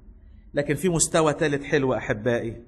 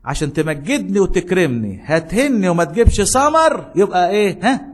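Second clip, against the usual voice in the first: preaching rebuke; 4 semitones higher, 4 dB louder than usual. Acted. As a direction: press the question on them. Preacher voicing God's rebuke.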